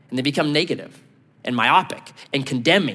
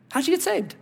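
"How'd you get saved?" asked much higher, softly probing; searching question; 12 semitones higher, 4 dB quieter than usual. Shown as a demonstration. Preacher pressing the congregation, high and probing. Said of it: "think about that for a moment".

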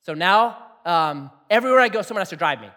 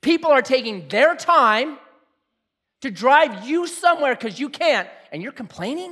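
God scolding; 6 semitones higher, 4 dB louder than usual. Retold or imitated. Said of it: "God had a little talk".

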